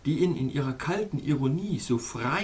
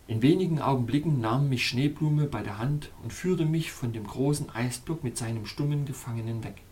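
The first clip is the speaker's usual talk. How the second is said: ordinary delivery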